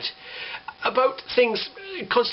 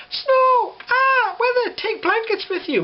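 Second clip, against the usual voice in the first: shrieking high mock alarm; mock panic; slower than usual. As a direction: ham up the panicked weather warning. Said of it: "screaming it from the rooftops".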